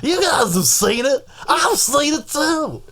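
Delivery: very slow, high announcer drawl; Will Ferrell as Harry Carey. Pretend. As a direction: drawl it like a goofy old announcer. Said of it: "Will Ferrell doing Harry Carey".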